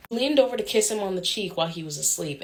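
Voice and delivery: ordinary delivery, nothing marked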